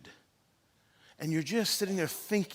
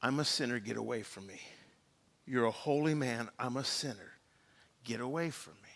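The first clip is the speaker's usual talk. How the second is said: low, subdued plea; humbled confession; 5 semitones lower, 5 dB quieter than usual. Acted. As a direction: shrink back softly, unworthy and ashamed. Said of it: "He fell down and said".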